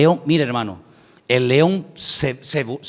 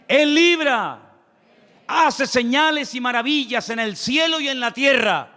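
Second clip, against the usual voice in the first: high, slow, ringing proclamation; fervent preaching; 9 semitones higher, slower than usual. Real, not acted. Preacher calls the congregation to say amen, fervently. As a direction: stretch each phrase out to rouse the congregation.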